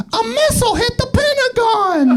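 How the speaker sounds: very high, squeaky talking-toy voice; Elmo. Acted. Toy repeating conspiracy to grandmother, Elmo voice. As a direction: pipe it out as a high-pitched toy. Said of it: "back to you in the Elmo voice".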